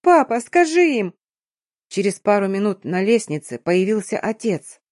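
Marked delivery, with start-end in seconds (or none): 0.0-1.1 high, drawn-out desperate appeal; pleading acted yell. A narrator's reading. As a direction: shout for dad to step in, slow and high. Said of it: "заорала я".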